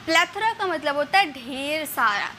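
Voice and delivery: usual speaking voice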